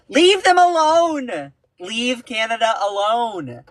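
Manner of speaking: high, drawn-out exasperated plea. Acted. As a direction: beg them to back off, stretched out. Pleading with the US.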